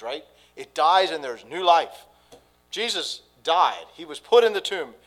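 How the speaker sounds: loud, high, fervent preaching; preaching at full force. Acted. Pastor urging the congregation toward hope, preaching full force. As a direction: lift the whole room with the good news.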